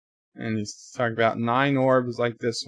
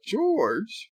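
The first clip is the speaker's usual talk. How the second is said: a high, surprised question; puzzled call; 10 semitones higher than usual. Retold or imitated. Sisko unsure who is there, a high puzzled call. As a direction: call the name as if you can't quite believe it.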